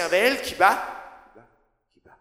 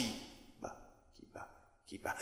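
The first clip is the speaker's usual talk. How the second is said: whisper=43; 12 semitones lower, 25 dB quieter than usual. Acted. Whispered refrain in poem.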